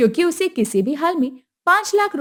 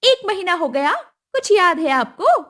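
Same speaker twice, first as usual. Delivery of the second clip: higher, pushy demand; demanding mother-in-law; 7 semitones higher, 4 dB louder than usual. Acted. Kusum demanding the dowry at the wedding, high and pushy. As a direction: ask for the money like it's owed.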